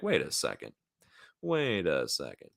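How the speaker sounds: mock suspicious double take, stretched out; dawning suspicion. Retold doormen slowly growing suspicious.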